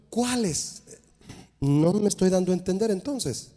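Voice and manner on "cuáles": soft, high, blank question; a light hint of a clueless youth; puzzled young man. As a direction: ask as if you never heard of them. Young man asks again.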